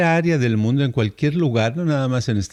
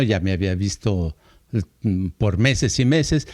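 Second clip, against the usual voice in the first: ordinary talk, nothing marked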